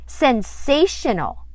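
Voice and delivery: proud declaration. Acted.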